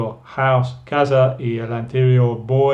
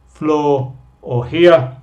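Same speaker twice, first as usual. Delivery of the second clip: slow model; slower than usual. Shown as a demonstration. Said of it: "va con el floor".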